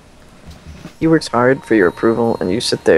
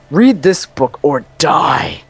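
slow, drawn-out mock threat, pitched up; angry librarian; 4 semitones higher, 3 dB louder, much slower than usual. Fed-up librarian throwing a book at a noisy guy, mock angry. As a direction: stretch it out as a hammed-up angry order. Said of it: "he's in an angry state".

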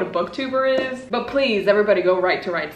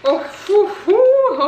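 excited; 12 semitones higher, 5 dB louder than usual. Real, not acted. Overwhelmed by new volumes.

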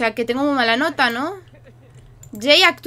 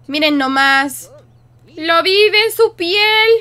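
slow, much higher and louder, hammed-up marvelling; theatrical mock amazement; mock dramatic awe; 5 semitones higher, 8 dB louder, slower than usual. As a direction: drag it out like a stunned spectator. Mock awe at sim acting.

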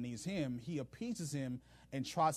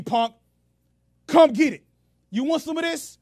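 much higher, much louder taunting bellow; taunting shout; 12 semitones higher, 16 dB louder than usual. Retold or imitated. Benhadad daring Ahab to fight, shouting. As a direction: dare him to come take it.

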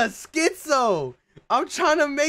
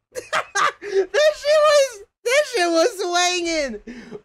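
high, slow, broken up with laughter; can't get the words out for laughing; 8 semitones higher, slower than usual. Real, not acted. Cracking up at the video.

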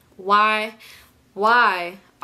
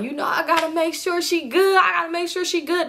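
showy mock concern; 8 semitones higher than usual. Acting out man showing off concern.

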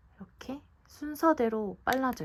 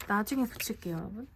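usual speaking voice

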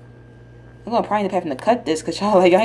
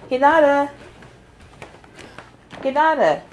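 calling the cat loudly; 7 semitones higher, 3 dB louder than usual. Real, not acted. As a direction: call her name across the house.

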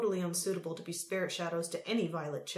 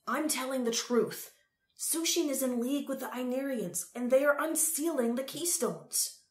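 raised pitch, urgent and emphatic; 6 semitones higher, 5 dB louder than usual. A narrator's reading. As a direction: press the warning home urgently. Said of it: "he said urgently".

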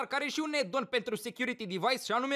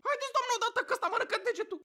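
high, rushed panicky urging; mock panic; 10 semitones higher, much faster than usual. Father with bitten finger begging the dentist, mock panicked. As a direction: hurry her up in a high frantic voice. Retold cry for dentist to hurry.

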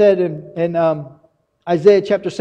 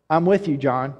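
low and soft, tender reassurance; God softly reassuring John, low and tender; God's gentle reassurance; 7 semitones lower, 5 dB quieter than usual. Acted. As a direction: say it lower and softer, like a quiet promise. God's comforting words, voiced.

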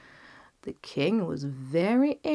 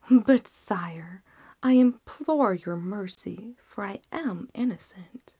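whispered, desperate plea; pleading physician; 2 semitones higher, 4 dB louder than usual. Acted. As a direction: beg for mercy under your breath.

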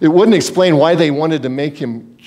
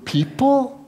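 soft, high, questioning disbelief; incredulous; 8 semitones higher, 4 dB quieter than usual. Acted. Preacher echoing Osteen's word in soft disbelief. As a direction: echo it in disbelief.

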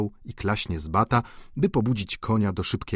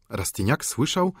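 ordinary delivery

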